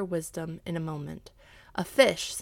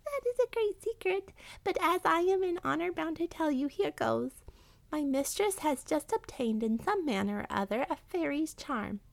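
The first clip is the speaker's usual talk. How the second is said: very high rat voice, confiding; 10 semitones higher than usual. Acted. Honor-bound rat telling the cat a secret, confiding.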